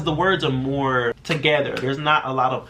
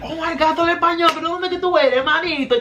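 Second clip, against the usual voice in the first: thrilled, very high and louder, laughing through it; a delighted customer gushing in Spanish; excited greeting; 5 dB louder than usual. Retold or imitated. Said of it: "you're like".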